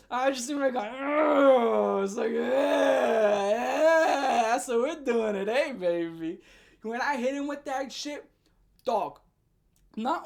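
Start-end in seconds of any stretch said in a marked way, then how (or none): 0.9-2.0 disgusted groan. Retold or imitated. Disgusted groan recalling glued hand. 2.3-6.4 gloating taunt. Retold or imitated. Gloating at classmate after prank.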